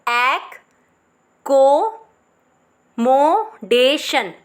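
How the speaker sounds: एक, को, मोडेशन with pauses; syllable by syllable. Shown as a demonstration. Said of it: "clear हो गया होगा". (रुक रुक कर)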